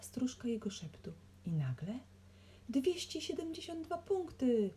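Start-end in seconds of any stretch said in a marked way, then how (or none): 2.7-4.7 high-pitched yelling out a score; sudden high cry from a businessman; yelled outburst. A narrator's reading. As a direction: blurt it out in a high yell. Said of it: "wydarł się biznesmen".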